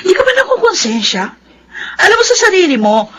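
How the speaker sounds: tearful accusation. Acted. Daughter confronts mother's fiancé, crying.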